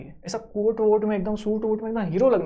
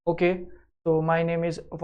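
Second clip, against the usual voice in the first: nervous restart; 5 semitones lower, 4 dB quieter, much slower than usual. Retold or imitated. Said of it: "ऐसा नहीं लगना चाहिए".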